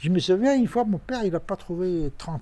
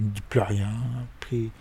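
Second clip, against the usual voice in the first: ordinary talk, nothing marked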